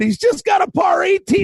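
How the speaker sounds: high, excited burst; excited exclamation. Real, not acted. Host to co-host, amazed by a par on eighteen, excited. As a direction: blurt it out in disbelief.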